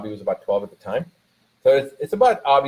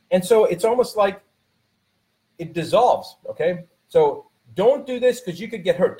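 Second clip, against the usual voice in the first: higher, driving motivational delivery; fired-up preaching; 7 semitones higher than usual. Acted. Speaker urging listeners to move past fear, fired-up preaching. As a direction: lift the room, push them to act.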